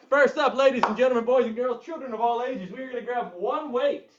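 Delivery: loud, high, fast ringmaster call. Pretend. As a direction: announce it like a circus ringmaster. Circus-style intro to workout.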